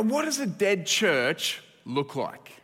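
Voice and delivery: ordinary talk, nothing marked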